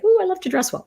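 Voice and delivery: high, lightly voiced fancy dresser; mock posh Europeans. About European style, light teasing fancy voice. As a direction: preen like someone who loves their clothes. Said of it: "it's always like".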